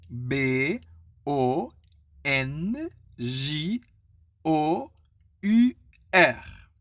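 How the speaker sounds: each letter separate, with pauses; spelled letter by letter. Shown as a demonstration. Teacher spelling bonjour for students, letter by letter. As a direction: spell it out slowly for learners. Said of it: "dire lettre par lettre".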